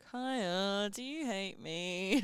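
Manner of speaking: soft, slow, drawn-out question; mock-pitiful little plea; needy check-in. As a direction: ask it timidly, stretching the words. Acts out checking on her roommate.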